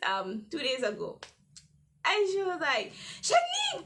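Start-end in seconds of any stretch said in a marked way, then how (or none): 3.2-3.8 teacher's shocked call. Retold or imitated. Teacher calling her name.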